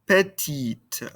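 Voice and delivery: "Petite" with a clearly released final T; sounded final T. Shown as a demonstration. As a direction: close "Petite" on an audible T. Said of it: "pronounce this ending consonant".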